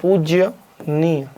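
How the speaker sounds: पूज्यनीय slowly split as पूज्य, pause, नीय; wrong form broken apart. Shown as a demonstration. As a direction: sound out the extra य in पूज्यनीय, then pause. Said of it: "तो ये तो गलत होता है". (पूज्यनीय को तोड़कर, धीरे-धीरे)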